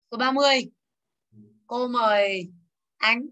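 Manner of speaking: ordinary talk, nothing marked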